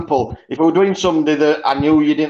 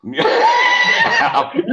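very high burst of laughter; high-pitched cackle; shrieking laugh. Real, not acted. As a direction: squeal with helpless laughter. Laughs at accent remark.

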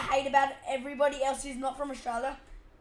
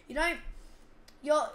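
ordinary delivery

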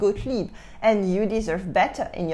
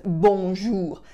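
drawn-out hammed French accent; French accent laid on thick; heavy movie French accent; 3 semitones lower than usual. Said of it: "with a super heavy accent".

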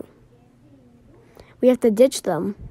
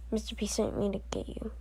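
urgent hushed whisper; 14 dB quieter than usual. Acted. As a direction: pass the message in a whisper. Secret message delivered quietly.

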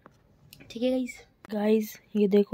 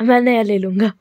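louder, stubborn repeat; loud insistence; 8 dB louder than usual. Real, not acted. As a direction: press the claim loudly. Repeats her claim over mother. (ज़ोर देकर ज़िद में)

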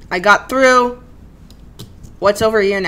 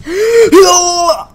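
sharp loud gasping cry; shocked cry; 10 semitones higher, 8 dB louder than usual. Real, not acted. Sudden shock.

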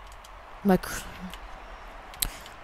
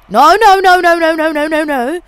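rapid string of high, loud cries starting to fall away; frantic gamer alarm; panicked shouting; 6 semitones higher, 11 dB louder than usual. Real, not acted. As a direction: shout it over and over in a panic, easing down. Panicking in the game.

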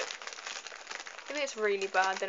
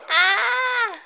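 loud, high scream; long high shriek of fright; startled scream; 12 semitones higher, 11 dB louder than usual. Real, not acted. Gamer caught off guard in the game, startled scream.

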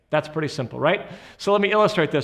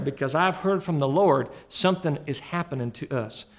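put-on Deep South accent. Husband telling his wife he's heard from the Lord, Deep South accent. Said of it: "trying to do your accent".

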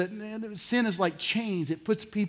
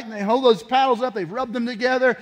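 ordinary talk

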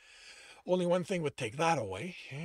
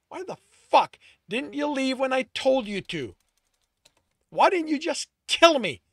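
louder and higher, ranting shout building to a yell; furious shout; 4 semitones higher, 10 dB louder than usual. A narrator's reading. Tristan yells his hurt at Alex, building fury. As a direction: let the rage climb to a scream.

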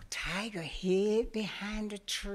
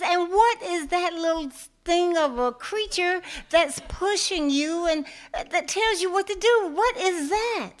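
high, loud, incredulous questioning; tiger's voice; 9 semitones higher, 7 dB louder than usual. Acted. Tiger scoffs at the little creature, high and loud. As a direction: demand it like a baffled bully.

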